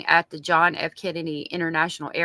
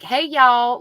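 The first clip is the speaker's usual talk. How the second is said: higher, louder Southern Hey, y'all; Southern drawl; 7 semitones higher, 7 dB louder than usual. Shown as a demonstration. Showing off her Southern accent to customers. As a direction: play up a thick Southern accent. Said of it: "because I have such a strong Southern accent".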